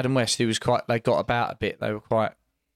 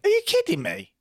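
incredulous. Real, not acted. Co-host can't believe his pal found Robin cool, incredulous high squawk. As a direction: pitch shoots up high in sharp disbelief.

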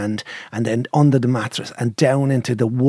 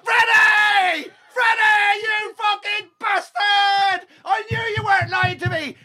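triumphant yell; slower than usual. Retold or imitated. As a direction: yell his name in triumph across the room. Yelling triumphantly at friend.

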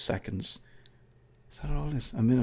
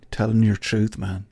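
ordinary talk, nothing marked

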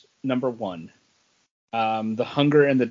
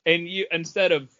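ordinary talk, nothing marked

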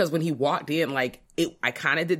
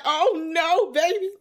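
high alarmed squeal; 14 semitones higher, 4 dB louder, slower than usual. Retold or imitated. Retold horrified reaction to screen.

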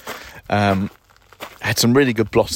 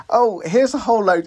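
breezy, much higher TV-presenter voice; mocking Monty Don; 12 semitones higher than usual. To listeners, mocking the TV gardener's ready-made trays, high breezy voice. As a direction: toss it off like a smug gardening host. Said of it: "he just said".

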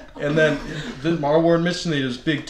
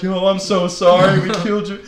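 frantic apology; 5 semitones higher, 6 dB louder than usual. Retold or imitated. Running up to owner, apologizing.

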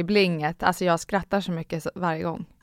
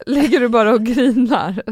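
ordinary talk

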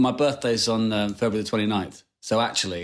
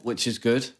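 ordinary talk, nothing marked

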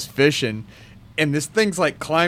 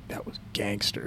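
whispered in awe; awed whisper; 14 dB quieter than usual. Retold or imitated. Stepkid impressed by the bite, awed whisper. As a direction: breathe it out, impressed. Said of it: "he was like".

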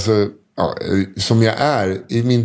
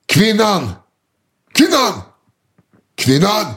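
shouting woman; 9 semitones higher than usual. Retold or imitated. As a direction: bellow it across the room like a drunk. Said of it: "hör man så här".

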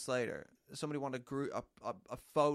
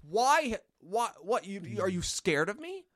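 high, loud taunting questions; imitated pushy woman, pitched up; needling promoter; 8 semitones higher, 8 dB louder than usual. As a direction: mimic her pestering, building to a louder call. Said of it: "And then she kept saying".

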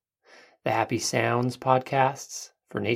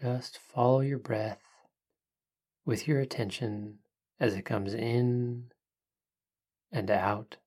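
soft spa whisper; 5 dB quieter than usual. Acted. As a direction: hush it down, calm and unhurried. Leads a guided breathing meditation.

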